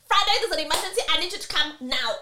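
high, loud emergency call; urgent retold plea. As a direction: re-enact an urgent demand for help.

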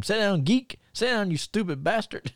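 high-pitched mock heckler putdown; high retold country put-down; Bunkhouse Buck. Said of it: "So I just told him".

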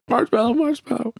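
high giggling marshmallow, said twice; laughing repeat. Real, not acted. Repeating a funny word.

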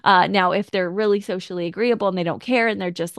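usual speaking voice

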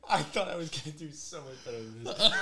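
laughing in defeat. Real, not acted. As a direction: talk through a helpless laugh. Cracking up while admitting failure.

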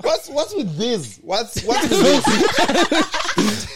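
slow, high laughing question; amused disbelief. Real, not acted. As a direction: drag it out through laughs. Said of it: "laughing at owls".

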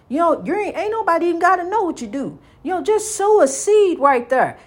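fervent preaching. Acted. Preacher urging listeners to be the change, fervent. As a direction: lift the crowd, pitch climbing. Preaching rises to full force.

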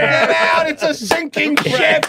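high, slow panicked mock yell. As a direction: shout a joking alarm. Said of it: "they're screaming".

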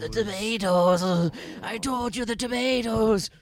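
yelling through a window from indoors; from inside a building. Acted. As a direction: shout it from inside the house. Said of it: "I'm yelling from inside the house through the window".

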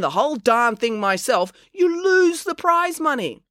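high-pitched tirade building to a wail; outraged yelling. A narrator's reading. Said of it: "she yelled".

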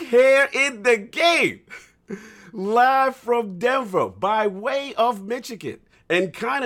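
loud, drawn-out ring announcer build-up; hype announcer intro. Acted. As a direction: sell the guest like a boxing announcer.

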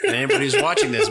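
laughing through the words; words breaking into laughter. Real, not acted. Laughing while addressing viewers.

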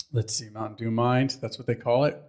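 ordinary delivery

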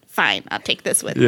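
imitating a sulky, low-voiced acceptance; mock upset. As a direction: drop low, like someone upset at being replaced. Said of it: "He just looks so upset".

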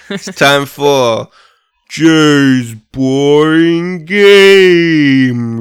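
slow, loud, drawn-out announcer; game show announcer. Acted. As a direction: boom out the show title.

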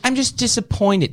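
high, slow, whiny mock voice; mocking high voice of the host. Guest mimicking the host's disappointment in a high whiny voice. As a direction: drag out the disappointment in a prissy squeak. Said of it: "do I sound like that?".

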